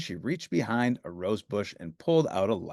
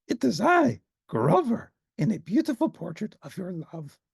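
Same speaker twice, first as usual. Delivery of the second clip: Grover voice; 8 semitones higher than usual. Acted. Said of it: "smiling bravely".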